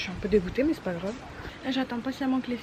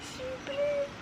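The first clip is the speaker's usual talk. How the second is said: high squeal; 5 dB quieter, slower than usual. Real, not acted. Gushing over the garden.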